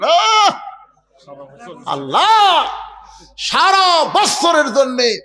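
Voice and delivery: high, ringing exclamation; fervent drawn-out cry; crying out to God. Real, not acted. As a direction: call it out with the vowel held. Cries out to God mid-exhortation.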